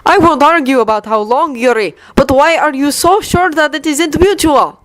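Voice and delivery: bitter. Acted. Victor challenges Yuri, bitter in his own way. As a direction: let the bitterness show. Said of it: "his own tone bitter".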